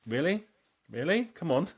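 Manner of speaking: high, rising disbelief in short bursts; incredulous. Retold or imitated. Younger ones reacting to foreigners, high incredulous mock. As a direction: mimic a sceptical, unimpressed reaction. Voicing sceptical younger people.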